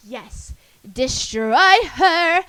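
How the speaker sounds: slow, loud gloating cheer; gleeful cheering on. Retold or imitated. Cheering June on against April, slow loud gloat. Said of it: "I feel like I'm like".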